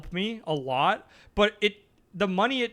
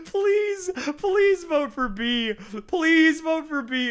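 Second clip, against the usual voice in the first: hammed-up fake crying, much higher, slow; mock sobbing plea; 12 semitones higher, 4 dB louder, much slower than usual. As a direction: whimper the plea like a begging child, slow and high. Mock begging chat to vote.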